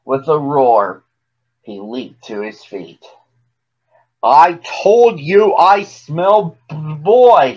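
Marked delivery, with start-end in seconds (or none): 4.2-7.5 loud, slow, high-pitched outburst; bellowing giant. A narrator's reading. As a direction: bellow it slowly, punching every word. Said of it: "he bellowed".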